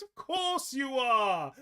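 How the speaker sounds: put-on high, slow knowing old nan. Nan covering her memory lapse, high and slow. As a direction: play it like she knew all along. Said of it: "And she goes".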